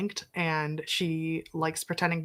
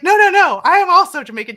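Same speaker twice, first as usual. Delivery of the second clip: louder and higher, eager retold insistence; excited retold reply; 11 semitones higher, 12 dB louder than usual. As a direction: jump in excitedly, a bit loud and high.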